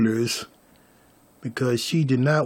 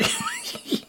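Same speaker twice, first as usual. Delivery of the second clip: soft high giggle; small breath of giggling; 12 dB quieter than usual. Real, not acted. Storyteller laughing that baby looks like daddy, soft giggle.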